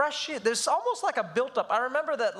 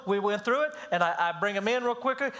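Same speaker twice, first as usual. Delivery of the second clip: ordinary talk, nothing marked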